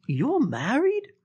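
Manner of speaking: high, drawn-out disbelief; incredulous. Retold or imitated. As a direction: imitate someone stunned by the news, pitch soaring.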